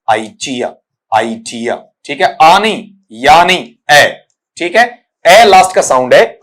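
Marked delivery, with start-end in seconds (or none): 0.1-1.8 lower, softer "आइटिया" with a short final uh; correct pronunciation. Shown as a demonstration. Teacher gives students the pure reading, pronounced correctly. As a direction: say "आइटिया" low, ending lightly in uh. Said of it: "बल्कि क्या बनेगा".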